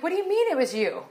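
high, astonished disbelief; stunned question. Retold or imitated. Asking her friend how the money was hers, high and astonished. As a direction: caught completely off guard.

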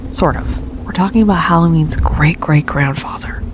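breathy secretive whisper; whispering while hiding. Pretend. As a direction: hush it like spies. Whispering, tells him keep voice down.

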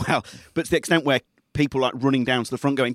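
usual speaking voice

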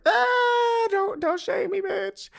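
very high squeaky whine; squealing plea. Real, not acted. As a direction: squeal it out, mock hurt. Playful plea after math fail.

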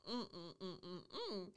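soft, repeated "mm" of pleasure; savoring hum. Acted. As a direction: hum softly, relishing it. Savoring the shower's water pressure.

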